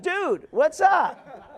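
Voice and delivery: drawn-out, high "Dude, what's up?"; laid-back son. Retold or imitated. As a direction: play the casual college kid greeting the boss. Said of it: "You'd be like".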